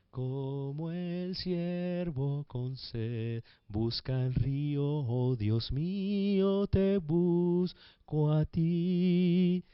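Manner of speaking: slow, hushed psalm recitation; poetic reading. Shown as a demonstration. Preacher reading a psalm to the congregation, slow and poetic. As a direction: savor each phrase like a prayer. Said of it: "Eso es narrar".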